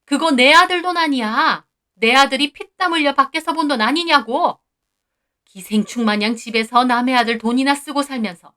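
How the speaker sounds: shrill-voiced shriek, loud and high; shrieking rage. Mother-in-law shrieking at the daughter-in-law, loud and high. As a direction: scream it at her, out of control. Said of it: "악을 쓰듯 말하더라고요".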